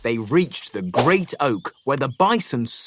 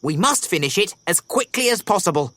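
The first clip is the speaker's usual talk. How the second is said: hasty; 6 semitones higher, faster than usual. A narrator's reading. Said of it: "hastened to add".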